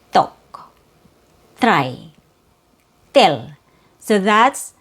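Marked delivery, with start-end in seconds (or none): 0.1-0.7 "tok" with Cebuano T, tongue tip between teeth; Cebuano accent. Shown as a demonstration. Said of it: "my native accent". 1.6-2.1 "try" with Cebuano T, tongue tip between teeth; lower-pitched Cebuano "try" with an unpopped T; Cebuano accent. Shown as a demonstration. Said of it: "my native accent". 3.1-3.5 "till" for tell, Cebuano T between the teeth; lower-pitched Cebuano "till" with an unpopped T; Cebuano accent. Shown as a demonstration. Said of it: "my native accent".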